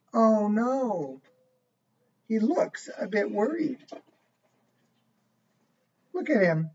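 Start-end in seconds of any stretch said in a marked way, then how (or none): none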